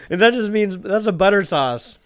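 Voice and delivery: high, loud, tickled explanation; still amused. Real, not acted. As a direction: explain it while recovering from laughter. Still giggling after own attempt.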